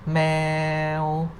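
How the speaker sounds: "Meow" drawn out on a level pitch; mid tone. Shown as a demonstration. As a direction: hold "Meow" long and even. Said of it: "no tone mark represent the mid tone".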